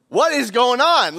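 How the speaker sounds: husband startled awake wife at dawn, surprised; startled question. Retold or imitated. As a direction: blurt it out in surprise. Husband startled by wife's outburst.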